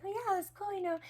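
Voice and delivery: hammed-up mom humoring her kid; mock enthusiasm. Mother humoring daughter about her toy, squeaky high praise. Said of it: "And I was like".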